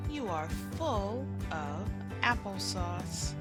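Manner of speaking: plain reading voice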